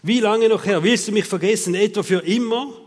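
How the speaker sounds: loud, hammed-up reproach; exaggerated frustration. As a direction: vent at God with overblown frustration. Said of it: "den Frust rauslassen tut man immer ein bisschen übertrieben".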